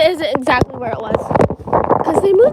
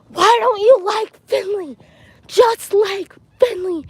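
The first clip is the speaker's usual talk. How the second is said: slow, higher-pitched mock yelling; imitated yell; 5 semitones higher, slower than usual. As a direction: imitate a friend pleading in a drawn-out yell. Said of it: "I hear her yell at him".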